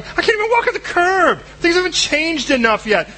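high, whining complaint; a high, whiny excuse to God; whiny protest. Acted. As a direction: whine it up high like a fretful kid.

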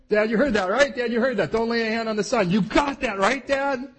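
high, loud, anxious nagging; urgent pleading. Retold or imitated. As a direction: make sure Dad heard, hammed-up. Isaac urging his father.

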